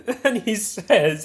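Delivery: high laugh spilling into the words; laugh, then struggle on with the story; cracking up. Real, not acted. Gamer about to quote the toxic player's reply, cracking up. Cracks up before reading next message.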